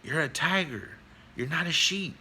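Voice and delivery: insistent tiger voice, rising in pitch; big tiger. Acted. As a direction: press the point as the big tiger. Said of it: "And he says".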